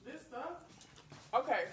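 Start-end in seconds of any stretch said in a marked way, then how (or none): 0.1-0.5 high whiny complaint. Retold or imitated. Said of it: "I'm like".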